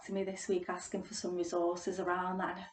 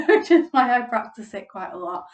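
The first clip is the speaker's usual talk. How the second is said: laughing; 3 semitones higher, 11 dB louder than usual. Real, not acted. Laughs at own joke.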